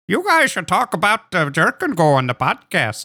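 mock Minnesota mom accent, starting high; lilting imitation of a Minnesota mother; Minnesotan soccer mom. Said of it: "Minnesotan, uh, soccer mom".

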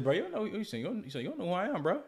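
high, fast sneering imitation of the guy; mocking the thief. Mimicking the wallet thief threatening him, high and fast. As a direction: squeak out his threat to make him sound silly. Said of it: "He said".

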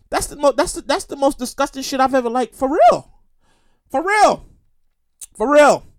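ordinary talk, nothing marked

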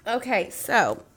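normal talking voice

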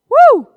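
sharp, high, loud woo; loud preacher's "woo!"; excited whoop. Real, not acted. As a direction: let out a quick burst of joy. Preacher exclaims over the scripture.

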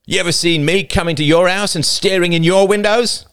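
high, loud indignant challenge; angry. A narrator's reading. Older boy challenging intruders about his house, angrily. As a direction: throw the question at them angrily. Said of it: "he said angrily".

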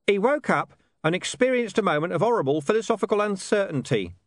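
high-pitched character voice. A narrator's reading. Hidden speaker makes excuses for an old dog, explanatory. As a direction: explain it from hiding, pitched well up.

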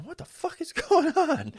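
high, shocked exclamation; startled outburst. Retold or imitated. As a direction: blurt it out in shock. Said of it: "I'm going".

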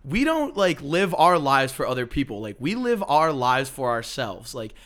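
high, loud sassy diva impression. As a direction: play the fabulous reassuring friend. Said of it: "walk and be like".

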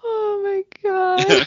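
high, slow drawn-out exclamation; amused disbelief. Real, not acted. Host reacting to the co-host's sister story, high amused disbelief. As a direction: stretch it out high, tickled.

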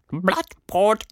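high creature voice chewing; munching while answering, high; mouth full. Acted. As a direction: say it through a mouthful of leaves. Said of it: "mit vollem Mund".

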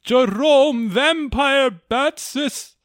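slow and eerie, drawn out; spooky voice. Acted. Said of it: "spooky voice".

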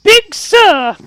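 squeaky high little fey shout; high squeak of a two-foot fey; tiny brownie voice. Acted. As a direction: pipe up like a tiny excited creature.

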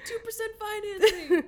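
high, loud laughing ad pitch; car ad pitchman. Pretend. Hosts parodying a car dealership ad, loud hammed-up pitch. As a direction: hype it like a used car commercial.

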